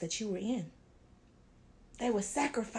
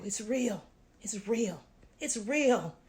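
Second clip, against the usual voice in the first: ordinary delivery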